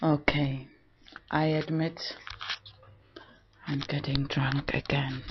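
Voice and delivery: soft, almost whispered admission; quiet whispered aside; hushed confession. Real, not acted. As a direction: confess it under your breath.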